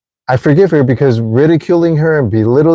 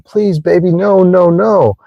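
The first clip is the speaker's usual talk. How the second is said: mock crying out; 8 semitones higher than usual. Speaker's old self begging a partner, mock desperate cry. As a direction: act out a desperate cry to a lover. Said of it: "would cry out and scream".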